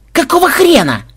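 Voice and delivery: high, loud yelp of disbelief; indignant shout. A narrator's reading. Programmer in the tree to his comrade, indignant shout.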